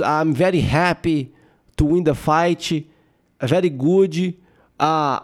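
put-on slow, halting English with Brazilian accent; thick Brazilian-accented English. Brazilian fighter giving condolences after the fight, heavy accented English. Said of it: "ela tem um inglês muito puxado".

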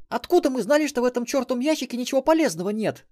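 put-on high, loud indignant young woman; sulky girl. A narrator's reading. Melissa sulks back at Xen, high and loud. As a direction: defend herself with a pout. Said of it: "насупилась Мелисса".

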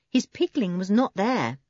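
usual speaking voice

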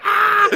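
high, loud mock shriek; hammed-up girly yelp; mock scream. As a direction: squeal like the startled princess. Princess screams being suplexed.

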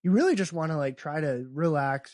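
ordinary talk

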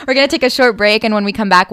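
upbeat radio host sign-off. Real, not acted. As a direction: announce the break like a DJ. Radio host break announcement.

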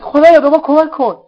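high, loud cry to God; desperate shouted prayer. Acted. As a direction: shout the plea in panic. Desperate plea during escape. (التماس فریادوار و زیر به خدا)

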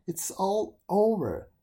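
slow, high, hopeless lament; despairing beetle. Acted. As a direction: sigh it out like all is lost.

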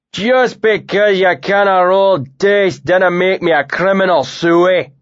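a burly northern drinker jeering at his mate; thick northern accent with a mocking edge. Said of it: "he said with a thick northern accent".

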